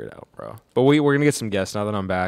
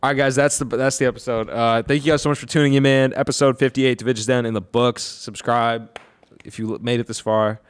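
upbeat louder sign-off to listeners; podcast outro; 7 dB louder than usual. Acted. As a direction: wrap up the episode like a host.

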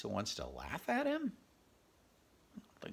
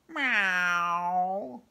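high, loud meow; meowing barn cat; 8 semitones higher, 12 dB louder than usual. Acted. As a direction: call down like a cat. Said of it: "he called down".

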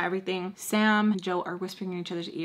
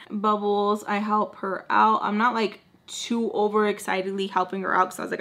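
ordinary delivery

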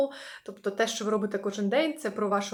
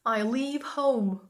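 slow model phrase; much slower than usual. Shown as a demonstration. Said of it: "teacher models phrase".

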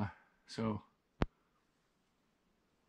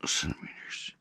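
whispered; 9 dB quieter than usual. Real, not acted. Quiet aside while measuring.

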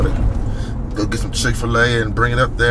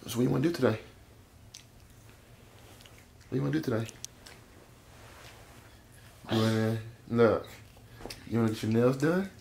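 much softer, gentle questions; 14 dB quieter, faster than usual. Real, not acted. Dad softly asking his daughter about her nails. Quiet baby talk to daughter.

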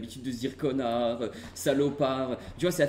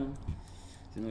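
normal talking voice

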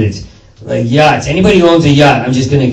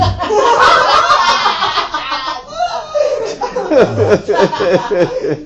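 high shrieking laugh; 3 dB quieter than usual. Real, not acted. Big laugh.

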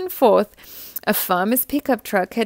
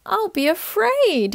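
high, small, fearful acorn voice; a tiny voice admitting fear; little acorn afraid; 11 semitones higher than usual. A narrator's reading. Little acorn telling mother his fear, high and small. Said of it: "he said".

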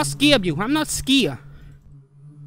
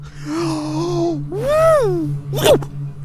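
gasp; 7 semitones higher than usual. Real, not acted. Scared gasp.

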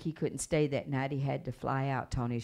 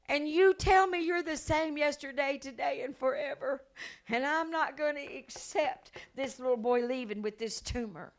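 high, insistent appeal to God; fervent prayer; 12 semitones higher, 4 dB louder than usual. Retold or imitated. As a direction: cry out to God, raised and unyielding. Acts out fervent prayer over child.

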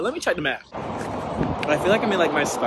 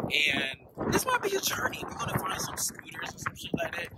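hushed whisper aside; 7 dB quieter than usual. Real, not acted. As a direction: whisper it to the camera.

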